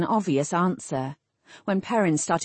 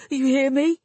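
high, rising, desperate question; pitched up, ending on a rise; desperate demand; 7 semitones higher, 4 dB louder than usual. A narrator's reading. Wounded Perrin orders them out.

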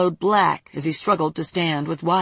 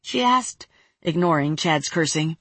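plain reading voice

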